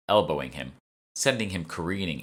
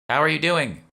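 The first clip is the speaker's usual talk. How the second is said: high, loud call through a door; raised voice checking on someone; 10 semitones higher, 7 dB louder than usual. A narrator's reading. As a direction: call across the bathroom to her. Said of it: "called Mona".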